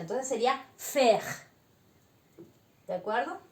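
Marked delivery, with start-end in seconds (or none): 0.8-1.2 faire as fe, no final vowel; French faire. Shown as a demonstration. Teacher repeating faire slowly for students. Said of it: "la E al final no se pronuncia".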